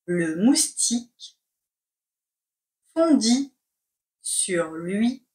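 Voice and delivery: slow, chunked with long pauses; slow dictation. Shown as a demonstration. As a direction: dictate word by word. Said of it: "C'est parti pour la dictée".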